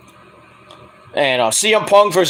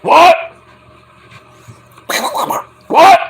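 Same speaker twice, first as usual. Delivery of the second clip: yelled What, much higher and louder; Stone Cold; 11 semitones higher, 6 dB louder than usual. Retold or imitated. Stone Cold yelling at the crowd, much higher and louder. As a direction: bark it like a wrestler on the mic. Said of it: "Stone Cold will come out like".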